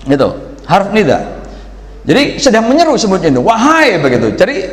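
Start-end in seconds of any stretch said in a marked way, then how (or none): 3.5-3.9 calling out. Shown as a demonstration. Preacher shows how the ant calls out. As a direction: call Wahai out to the whole colony. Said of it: "wahai itu mesti bengok, bukan bisik-bisik".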